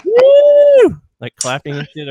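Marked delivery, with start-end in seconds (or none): 0.0-1.0 very high, loud whoop; imitated cheering shriek; crowd whoop. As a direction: let out a piercing celebratory woo.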